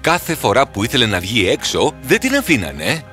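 usual speaking voice

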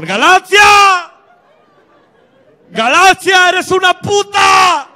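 high, full-force drawn-out yell; shouting. Acted. Ezequiel still yelling at Galaxia from afar, high loud shout. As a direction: scream the insult across the party. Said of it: "sigue gritando el cabrón".

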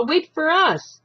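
high loud yell; Ba yelling at the departing streetcar; shout. A narrator's reading. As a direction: yell after the car. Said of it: "shouted Ba".

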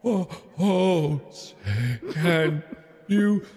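halting, shaky attempt at singing, breaking high; voice cracking. Scared kid made to sing for party guests, voice cracking. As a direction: choke out the song until the voice cracks. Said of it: "my voice cracks immediately".

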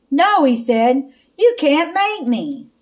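loud, high wail of refusal; cling and wail no; frightened child wailing that he won't go; wailing protest. Acted. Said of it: "Clark wailed".

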